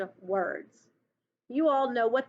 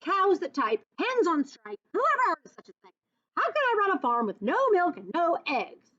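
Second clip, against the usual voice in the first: furious farmer; 7 semitones higher than usual. Acted. Farmer Brown ranting about striking cows and hens, much higher. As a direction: rant like a fed-up farmer. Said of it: "Farmer Brown was furious".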